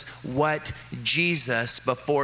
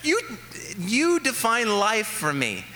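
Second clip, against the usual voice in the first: amused disbelief; 10 semitones higher than usual. Real, not acted. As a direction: stretch it out in laughing disbelief. Amused mock outrage at kids.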